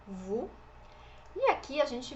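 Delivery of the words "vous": vous said as vu, a pure rounded u; vous with no s at the end; French u sound. Shown as a demonstration. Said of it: "som de u". (vous com som de u fechado)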